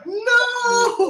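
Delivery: very high, loud, drawn-out wail of dismay; mock dismayed cry. Instructor losing a star student to a coffee shop, mock wail. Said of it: "You're like".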